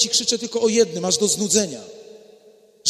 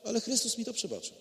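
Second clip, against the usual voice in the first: soft, quiet and calm; calm answer; 13 dB quieter, faster than usual. Pretend. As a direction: answer peacefully, softly. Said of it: "będziesz mogła spokojnie odpowiadać".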